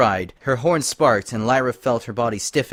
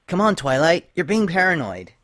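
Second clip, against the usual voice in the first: higher, exasperated friend; chiding Dash; 7 semitones higher, 3 dB louder than usual. Acted. Dash telling Twilight she's paranoid, mildly exasperated. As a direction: brush off her worry impatiently.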